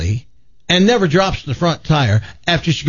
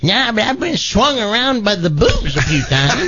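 granny punchline; 8 semitones higher than usual. Acted. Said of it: "He says".

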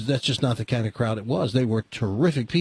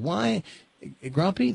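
put-on high, pleading little-kid question; lightly mimicked child asking why; small grandson's baby talk; 7 semitones higher, slower than usual. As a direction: imitate a puzzled toddler, pitched way up. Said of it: "in baby talk".

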